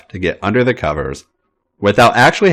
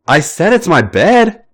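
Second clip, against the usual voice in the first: bratty; 8 semitones higher than usual. A narrator's reading. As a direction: insist like a spoiled little brother. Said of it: "fully back into his brattiest mode".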